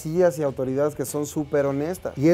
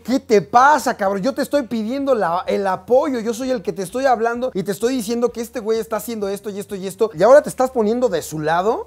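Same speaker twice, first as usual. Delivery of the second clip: higher, louder furious outburst at the cop; angry yelling; 7 semitones higher, 4 dB louder than usual. Retold or imitated. Yelling at the policeman who took the marine's side, angry. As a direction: let the anger spill over. Porque sí estaba enojado.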